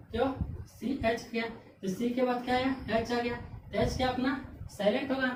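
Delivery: ordinary delivery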